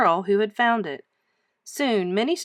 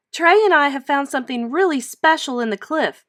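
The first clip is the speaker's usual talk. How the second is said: excited; 6 semitones higher, 5 dB louder than usual. Acted. Girl tells grown-ups her find.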